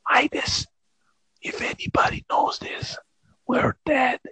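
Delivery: hushed frightened whisper. Retold or imitated. Host warns Midas they're dead, whispering. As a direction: whisper it like someone listens. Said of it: "And I whispered all night".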